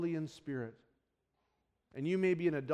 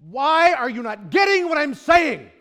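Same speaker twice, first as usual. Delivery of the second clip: loud, higher burst of frustration; exasperated outburst; 7 semitones higher, 12 dB louder than usual. Retold or imitated. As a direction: let the patience snap at the spouse. Exasperated spouse not understood.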